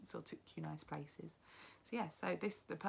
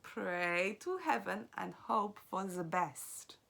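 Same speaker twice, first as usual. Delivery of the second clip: slow, louder solemn grandmother; 5 semitones higher, 9 dB louder, slower than usual. Acted. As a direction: give grave advice as grandma. Grandmother instructs.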